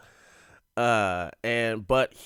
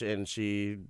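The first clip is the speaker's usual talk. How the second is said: usual speaking voice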